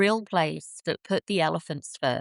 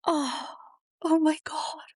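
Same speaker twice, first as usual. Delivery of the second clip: amazed gasp; 10 semitones higher than usual. Retold or imitated. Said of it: "and I just went".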